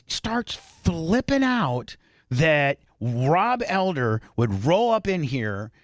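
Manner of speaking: ordinary delivery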